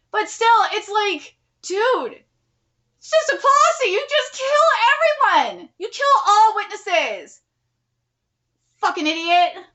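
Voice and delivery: high, loud, slow insistence; a real tirade, pitched high; exasperated rant. Real, not acted. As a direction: hammer home the obvious. Reader rants at the characters.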